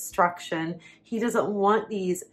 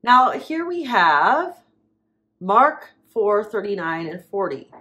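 ordinary talk, nothing marked

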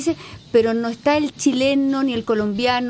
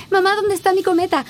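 higher-pitched child imitation, neatly pronounced; imitated small child; 7 semitones higher than usual. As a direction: mimic a little kid asking mum. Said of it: "cambiando la cosa con los niños chicos".

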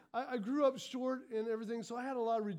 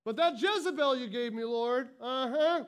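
hammed-up self-pitying wail, louder; whiny victim; 5 dB louder than usual. Preacher mocking a victim blaming Jezebel, loud whine. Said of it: "if you're called a Jezebel".

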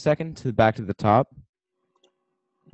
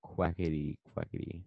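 ordinary talk

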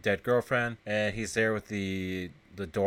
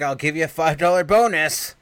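loud, higher excited offer, retold; 5 semitones higher, 8 dB louder than usual. Retelling a film man's offer during a tryst, excited. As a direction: act out an eager, loud offer.

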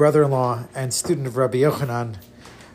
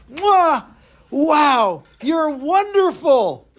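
delighted praise; 11 semitones higher, 3 dB louder, much slower than usual. Acted. Rebbe kisses and praises him.

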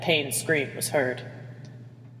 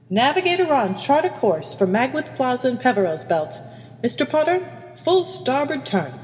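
higher-pitched commanding captain; captain's orders; 8 semitones higher than usual. Acted. Captain sets the ship's course, commanding. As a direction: give crisp orders to the bridge.

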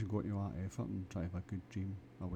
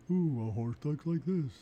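high, loud, slow goofy horse voice; Mister Ed; 7 semitones higher, 7 dB louder, slower than usual. Retold or imitated. Friends imitating how a horse would talk, silly and high. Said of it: "like Mister Ed".

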